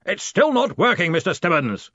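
loud, high bellow of complaint. A narrator's reading. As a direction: bellow it at your assistant. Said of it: "he bellowed".

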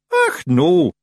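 Feegle voice. A narrator's reading. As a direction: squeak it out like a tiny Scots pixie. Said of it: "said a voice from under the bed".

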